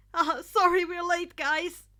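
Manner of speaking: loud awkward apology. Acted. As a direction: blurt out the sorry, embarrassed but cheerful. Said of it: "with an awkward smile".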